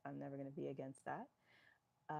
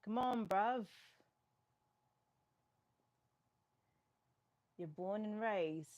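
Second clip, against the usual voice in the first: put-on playful London accent, higher and louder; English accent; 6 semitones higher, 6 dB louder, slower than usual. Answering a chatter's English accent question in a playful London accent. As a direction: lay on a cheeky London bruv. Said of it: "That's the best I'm gonna give you as far as accents go".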